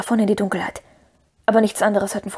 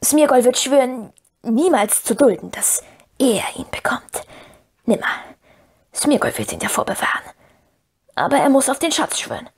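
hoarse creature whisper rising at the end; Gollum whispering hoarsely; 3 semitones higher than usual. A narrator's reading. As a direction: swear in a trembling, husky whisper. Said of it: "heiser flüsternd".

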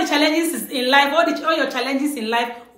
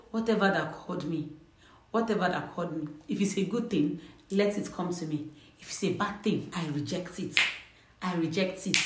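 low, hushed murmur, much softer; whispering back; 5 semitones lower, 10 dB quieter than usual. Shown as a demonstration. Viewer answering an unseen caller who whispered, hushed reply. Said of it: "Whisper back and say".

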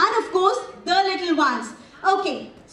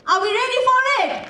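excited hype; 5 semitones higher, faster than usual. Real, not acted. Host hyping the crowd.

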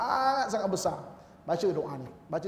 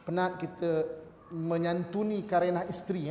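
ordinary talk

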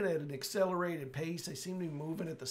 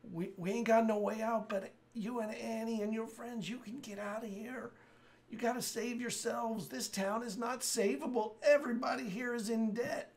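higher, urgent dying plea; dying man voice; 7 semitones higher than usual. Acted. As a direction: beg them to escape.

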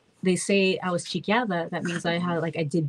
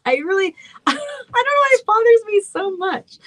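laughing at herself; 13 semitones higher, 6 dB louder than usual. Real, not acted. Laughing at fussing with bangs.

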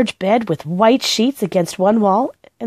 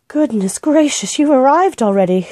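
high, horrified gasp of a greeting; shocked at the early arrival, voice up high; in horror; 6 semitones higher, 3 dB louder than usual. A narrator's reading. Housekeeper greeting the children, in horror. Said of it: "she said in horror".

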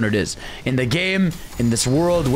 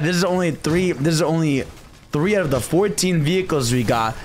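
ordinary talk, nothing marked

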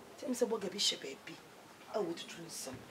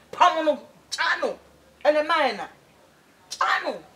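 emphatic urging; 7 semitones higher, 9 dB louder than usual. Real, not acted. Host presses the caller to leave her, loud and insistent. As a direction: push the caller hard to act.

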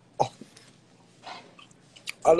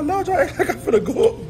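laughing; 4 dB louder than usual. Real, not acted. Laughing at fans' sad goodbyes.

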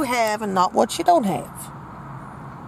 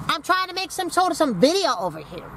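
ordinary talk, nothing marked

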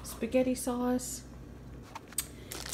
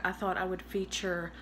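normal talking voice

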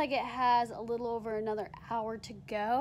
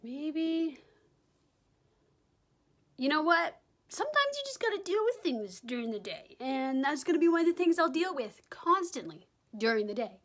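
usual speaking voice

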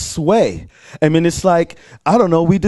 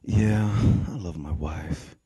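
low, soft, halting reply; acted sheepish mumble, very soft; subdued admission; 11 semitones lower, 9 dB quieter than usual. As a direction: concede it quietly after a pause. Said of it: "I'm like".

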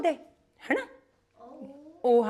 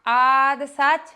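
slow recitation; 3 semitones higher, much slower than usual. Shown as a demonstration. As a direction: recite slowly for the class to echo. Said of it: "ਮੂਲ ਮੰਤਰ ਤੋਂ ਸ਼ੁਰੂ ਕਰਦੇ ਹਾਂ". (ਹੌਲੀ ਤੇ ਉੱਚੀ ਪਾਠ ਵਾਂਗ ਬੋਲੋ)

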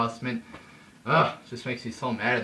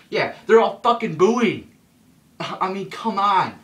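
much higher and louder, fed up; exasperated outburst; 9 semitones higher, 10 dB louder than usual. Real, not acted. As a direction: blurt it out in disbelief. Exasperated at the crowd reaction.

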